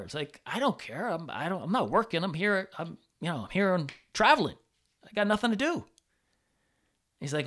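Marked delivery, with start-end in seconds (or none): 0.4-5.9 higher, louder retold eagerness; eager insistence. Traveler assuring teacher that distance doesn't matter, eagerly insistent. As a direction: brush off the objection, pitched up and keen.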